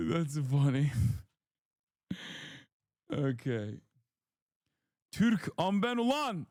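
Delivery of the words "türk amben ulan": mock Turkish bravado. Mock Turkish nationalist shout.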